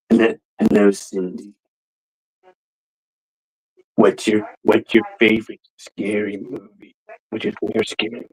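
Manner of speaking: low, soft killer without a voice changer; Ghostface. Shown as a demonstration. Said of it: "here's the Ghostface voice without the".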